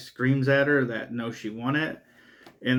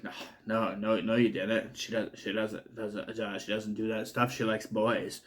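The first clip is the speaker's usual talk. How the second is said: lower, much softer, disbelieving mumble; stunned denial; 4 semitones lower, 9 dB quieter than usual. Retold or imitated. Father denying his daughter's secret, low and soft. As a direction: deny it quietly, voice dropping. Dad refusing to believe daughter news.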